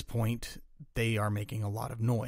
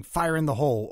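higher, louder narrated warning shout; mock yell; 5 semitones higher, 7 dB louder than usual. A narrator's reading. Japanese parroting Americans hurling grenades, a mock yell. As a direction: call it out like a grenade warning, short of screaming. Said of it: "parroted the Americans hurling grenades and yelling".

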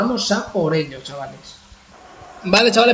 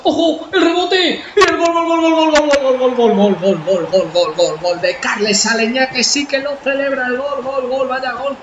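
breakneck goal commentary; 4 semitones higher, 3 dB louder, slower than usual. Real, not acted. Goal scored in football game.

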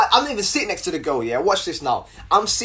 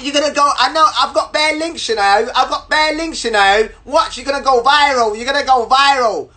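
high, loud put-on rant; threatening girl imitation; 6 semitones higher, 4 dB louder than usual. Girl warning she'll make him go viral, agitated mimicry. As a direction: rattle off mock threats in a high voice.

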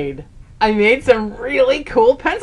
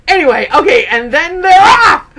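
excited; 7 semitones higher, 7 dB louder than usual. Real, not acted. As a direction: announce the start like a race. Race starting in the story.

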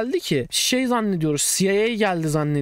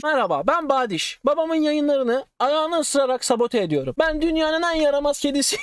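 cat voice; 7 semitones higher than usual. Pretend. Voices his cat Badiş.

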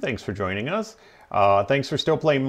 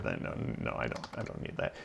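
whisper; 14 semitones lower, 16 dB quieter, faster than usual. Retold or imitated. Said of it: "the guy is like".

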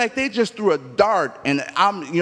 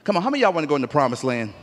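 usual speaking voice

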